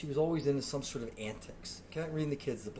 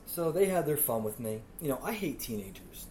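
ordinary delivery

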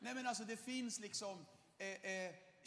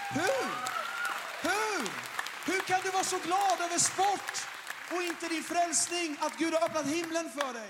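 high, shouted, fervent challenge; impassioned preaching; 10 semitones higher, 18 dB louder than usual. Acted. Preacher confronting his friend about joy in salvation, shouting. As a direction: demand it of them at full force.

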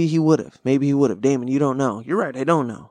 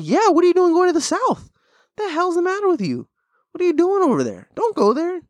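incredulous friend. Retold or imitated. Telling a friend off, high incredulous voice. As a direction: scold a friend for going South. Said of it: "I'm like".

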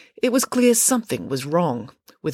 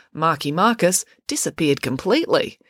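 usual speaking voice

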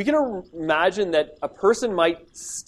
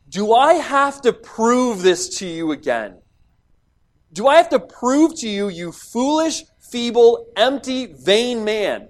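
impatient scolding, higher and louder; not kind at all; 5 semitones higher, 5 dB louder than usual. Retold or imitated. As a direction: rebuke a foolish, empty man who won't learn. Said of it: "he's not saying it in a really kind way".

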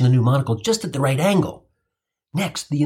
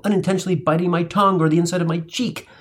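usual speaking voice

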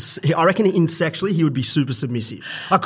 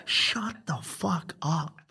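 slow and much softer, half-hearted shut-up; mock muttered reply; 10 dB quieter, much slower than usual. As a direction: drag it out quietly like you're rattled but laughing it off. Retold reaction to a wind-up.

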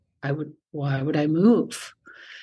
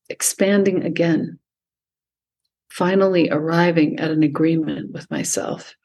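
calm, unhurried meditation voice with long pauses; guided meditation. Acted. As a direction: guide the listener gently into stillness. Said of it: "lead us through any sort of meditation".

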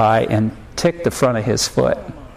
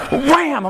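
high-pitched imitated punch yell; sudden high "wham", drawn into a yelp; mock punch cry; 13 semitones higher than usual. Dad imitating his embarrassed son punching him, high mock yell.

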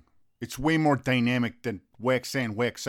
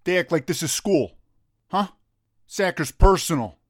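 louder, higher, worked-up tirade; heated rant; 5 semitones higher, 5 dB louder than usual. Acted. Buddy rants to the coach about by-the-book training, loud and heated. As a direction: get fired up and hammer the point.

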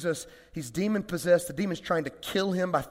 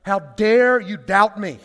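slow, louder mock fury; mock outraged Jesus; 2 semitones higher, 8 dB louder, slower than usual. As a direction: ham up an outraged Jesus for the joke. Mock angry Jesus joke.

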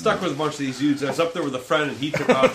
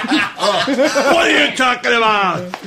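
loud, high, drawn-out cranky shout; Costanza's father; 6 semitones higher, 4 dB louder, much slower than usual. Acted. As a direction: yell it slowly like an exasperated sitcom dad. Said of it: "sounds like Costanza's father".

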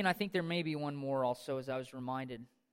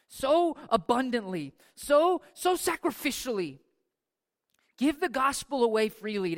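builds louder and higher to a call; rising exhortation; 7 semitones higher, 8 dB louder than usual. Acted. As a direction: urge them on, swelling.

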